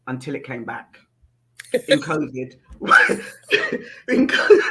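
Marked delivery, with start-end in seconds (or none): 2.8-3.3 very high, loud laughing shriek. Real, not acted. Sudden laugh.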